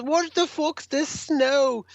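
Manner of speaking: high, slow, grumbling rock star voice. Retold or imitated. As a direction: drag out the complaint like a cranky old rocker. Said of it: "He goes".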